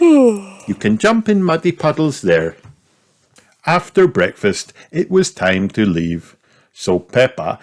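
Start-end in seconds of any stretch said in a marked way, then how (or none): 0.0-2.6 mum voice. A narrator's reading. Mummy Pig reply voiced high.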